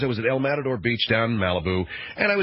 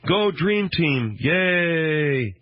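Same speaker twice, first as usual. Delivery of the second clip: mock cheering; 4 semitones higher, much slower than usual. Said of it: "are sitting there and going".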